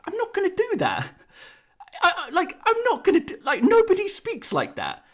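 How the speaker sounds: high-pitched incredulous protest; exasperated protest. Real, not acted. YouTuber refusing to say Robertson the Scottish way, high and exasperated. Mock outrage at absurd demand.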